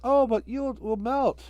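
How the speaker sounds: put-on high, slow, worried little girl. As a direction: fret gently for your friend. Karen's voice.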